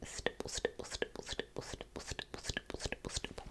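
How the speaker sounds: very soft rhythmic whisper; whispered trigger words. Acted. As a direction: breathe the words out softly, barely there. ASMR trigger words while cleaning.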